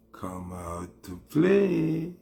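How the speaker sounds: slow, drawn-out sing-song taunt; creepy taunt. Acted. Slowly taunting Kartel to come out and play. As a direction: lure him out like a playground bully.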